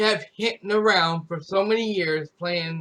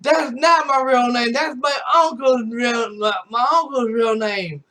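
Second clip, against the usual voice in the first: higher, louder flustered shout; retold denial; 6 semitones higher, 4 dB louder than usual. Streamer lies to chat about his name, flustered shout. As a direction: bluff loudly to cover up. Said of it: "I said".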